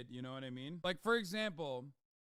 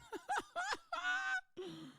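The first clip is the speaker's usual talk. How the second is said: a sudden high laugh that falls away; high burst of laughter; 3 dB quieter than usual. Real, not acted. Streamer laughing at his own caveman bit, high laugh. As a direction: cackle up high, then let it drop.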